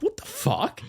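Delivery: high-pitched disbelief; incredulous. Retold or imitated. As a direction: squeak it out in shock. Discovers phone was never charged.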